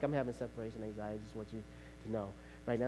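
a soft, low, hurried admission; hushed confession. Retold or imitated. Husband softly confessing anxiety to his wife. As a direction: admit it quietly, almost under the breath.

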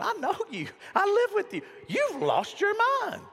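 very high mock shriek; Mike, exasperated. Wife scolding dancing David, high-pitched mock exasperation. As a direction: rise into an incredulous high cry, dragging the last line. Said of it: "Mike was going".

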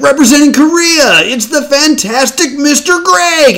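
high, loud showman announcement; announcer voice. Pretend. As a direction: boom it out like a game show host.

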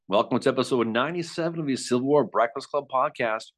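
normal talking voice

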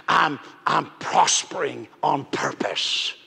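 slow whisper, like a secret. Acted. Pastor confiding his giving habit, whispered. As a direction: breathe the words slowly.

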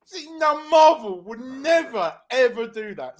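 high, slow, drawn-out teasing; mock smug remark. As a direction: savour the dig at Marvel. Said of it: "And I was like".

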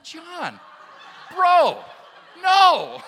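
high, very loud exasperated protest; appalled mock shout. Youth leader reacting to John's embarrassing word, high appalled shouting. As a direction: cry out in comic disbelief, stretching each word.

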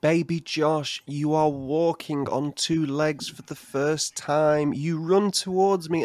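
normal talking voice

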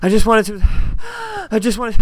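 mock breathless, slow halting words; imitating an out of breath caller. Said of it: "Kitty seems very out of breath".

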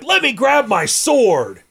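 high, stretched-out mock bravado; eager ghost brother. Ghost brother reaching for his sword, eager.

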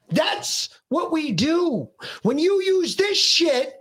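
slow, high-pitched retold scolding; yelling tirade. Dad lectures son about phones, yelling tirade. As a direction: re-enact a heated lecture, stretching every phrase. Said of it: "after he was done yelling".

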